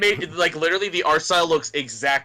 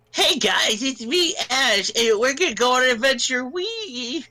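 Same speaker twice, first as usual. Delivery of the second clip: high, excited cartoon kid; squeaky young hero voice; high cartoon boy voice; 12 semitones higher than usual. Ash voiced like Tara Strong.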